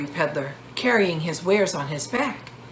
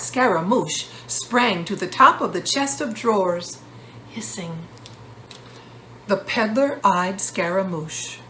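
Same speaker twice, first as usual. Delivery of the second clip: usual speaking voice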